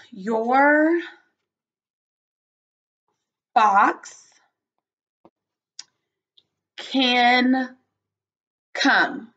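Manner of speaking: drawn-out words with long pauses between; stretched, spaced-out teacher dictation. Shown as a demonstration. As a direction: say each word separately and slowly for writing. Teacher composes sentence word by word.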